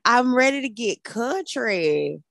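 put-on slow, drawn-out Texas country accent. Co-host announcing she is going country, Texas accent. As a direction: stretch it into a playful Texas drawl. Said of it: "flexing my Texas accent".

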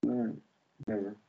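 too lazy to wake up; unbothered sleepy dog. Retold or imitated. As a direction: voice a dog that can't be bothered. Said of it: "My dog doesn't even wake up. He's just like".

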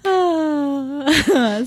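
high mock wail; hammed-up sob; fake crying. Mock crying game continues.

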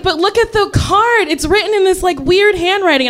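put-on high insistent kid voice; pleading little girl. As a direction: insist like a small child. Sister pleading evidence.

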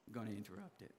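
ordinary talk, nothing marked

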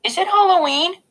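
put-on a toddler's innocent question; small child asking. A narrator's reading. Little brother asks the babysitter, high child voice.

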